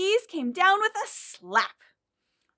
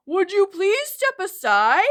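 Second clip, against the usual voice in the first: polite call to the moose; 5 semitones higher, 8 dB louder than usual. Acted. Character calls to the moose.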